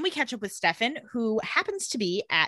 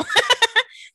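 high burst of laughter; 12 semitones higher, 7 dB louder than usual. Real, not acted. Laughing at Elena heading to the grill, loud and high.

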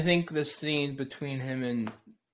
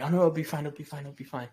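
imitating manic reassurance, starting higher and louder; lightly acted manic insistence; mock manic; 4 semitones higher, 4 dB louder, faster than usual. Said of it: "how manic he is and just like".

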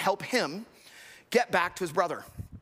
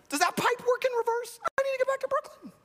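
higher, hammed-up plea; pretend Mario; 13 semitones higher than usual. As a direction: squeak out Mario's hypothetical plea. Preacher voicing Mario hypothetically.

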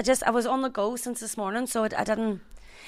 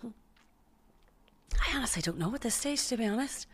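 whispered aside; 3 semitones higher, 6 dB quieter, slower than usual. Real, not acted. Quiet unsure reply.

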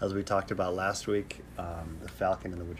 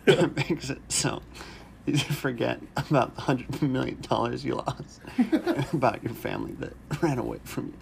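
laughing through it; 4 semitones higher than usual. Real, not acted. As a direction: try to stay straight but keep laughing.

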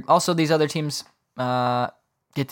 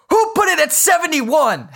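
much higher, slow, indignant mock outburst; imitating upset outrage; 13 semitones higher, 3 dB louder than usual. Friend's roommate finding the thermostat changed, mock outraged and high. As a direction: ham up his outrage at the thermostat. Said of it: "straight up upset".

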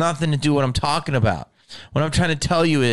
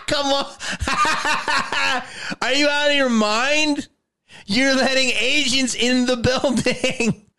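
incredulous laughing; 11 semitones higher than usual. Real, not acted. Laughing in disbelief at agents.

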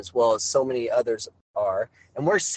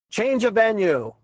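calling out; 8 semitones higher than usual. Retold or imitated. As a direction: yell it from the packed bleachers. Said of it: "So I scream out".

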